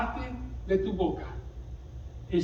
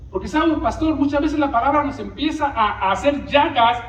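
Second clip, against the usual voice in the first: louder, higher, driving the point home; full-force preaching; 6 semitones higher, 5 dB louder than usual. Acted. Preacher to congregation, preaching at full force. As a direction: preach it to the back row.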